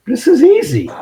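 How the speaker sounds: high-pitched mock overconfidence; cocky delight. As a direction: crow it like a rookie on a roll. Rookie gloats after two sales.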